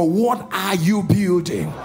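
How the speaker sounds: slow, loud drawn-out question; slow call to neighbor. Shown as a demonstration. Pastor prompts congregation to ask neighbors, slow loud call. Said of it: "Say it again to your neighbor".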